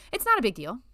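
breezy dismissal covering anger; bright. Acted. Letty brushing off Trishna's sympathy, bright and breezy. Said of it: "she said brightly".

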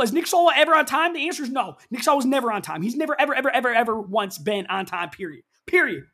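hammed-up outrage about a late friend; mock-outraged rant. Real, not acted.

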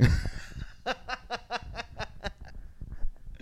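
high, wheezy laughing fit; long run of high, quiet laughter; real giggle at your own joke. Real, not acted. Laughs at own remembered reference.

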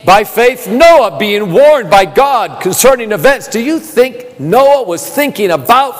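impassioned pulpit delivery over music; full-force preaching. Acted. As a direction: lift the congregation, ringing and urgent.

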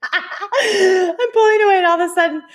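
high, giggly storytelling; squeaky with laughter; laughing. Real, not acted. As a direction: fight through real laughter to tell it. Laughing at her own story.

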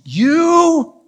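high, loud drawn-out shriek; yell at lungs' top. Retold or imitated. Wife shrieking at her husband after the pinch, top-of-lungs yell. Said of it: "she yells at the top of her lungs".

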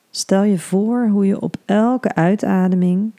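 soothing hypnobirthing guide; guided meditation. Acted.

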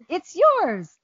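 high, loud "It's yours" blurted with relief; eager handoff. Retold or imitated. To the IT guy, she quotes her relieved high giveaway. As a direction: hand it over fast, glad to be rid of it. Giving away the unwanted porcelain iguana.

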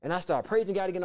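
ordinary talk

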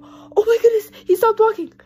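high, loud alarmed cry of a worried mother; panicked cry. Retold or imitated. Mother crying out to her daughter that the dog stopped walking, alarmed high cry. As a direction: shriek it out in alarm. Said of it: "all of a sudden I hear her go".

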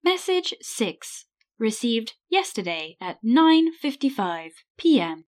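stilted robotic voicemail announcer; word by word, with stepped machine pitch; answer phone voice. Acted. Answering machine reading out message times, robotic. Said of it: "Did you like my answer phone voice".

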